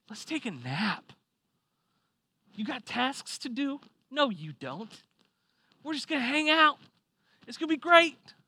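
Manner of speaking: relaxed, rising into excitement; lazy Sunday glee. Retold or imitated. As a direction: sell the joy of a do-nothing Sunday. Voicing lazy Sunday plans.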